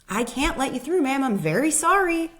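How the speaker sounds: attendant's meaningful refusal; knowing attendant. Retold or imitated. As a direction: say no while hinting at the door. Attendant hinting at the door.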